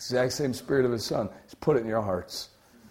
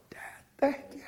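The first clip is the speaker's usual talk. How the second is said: whispered thanks to a father; whispered prayer; 6 dB quieter, faster than usual. Acted. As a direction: whisper it as a grateful child. Voicing a believer's prayer.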